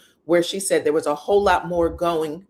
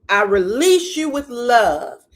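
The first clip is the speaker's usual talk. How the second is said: slow, louder mock declaration with RELEASE and LOVE drawn out; emphatic retold release; 4 semitones higher, 7 dB louder, slower than usual. Retelling Destiny's release of her friend, slow emphatic mock declaration. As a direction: proclaim it grandly, leaning on "release" and "love". Acting out a grand dismissal.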